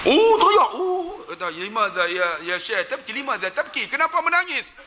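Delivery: high, loud rising cry of wonder; emotional cry. Retold or imitated. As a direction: cry out in awe at the weeping sheikh. Sheikh weeps, speaker cries out. (jeritan terharu yang tinggi)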